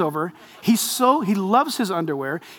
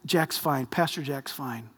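soft aside; 7 semitones lower, 11 dB quieter, slower than usual. Retold or imitated. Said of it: "And I'll say to you".

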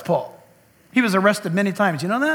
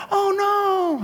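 alarmed cry; 12 semitones higher than usual. Retold or imitated. Dog about to crash into him.

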